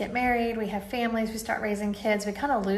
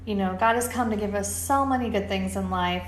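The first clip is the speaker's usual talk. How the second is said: usual speaking voice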